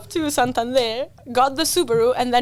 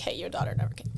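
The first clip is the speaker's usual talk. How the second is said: whispered news; 6 semitones lower, 11 dB quieter, faster than usual. Retold or imitated. University email to mom.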